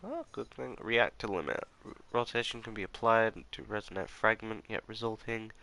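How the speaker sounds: ordinary talk, nothing marked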